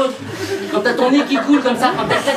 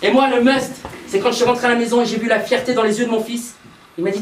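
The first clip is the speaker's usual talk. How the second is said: ordinary talk, nothing marked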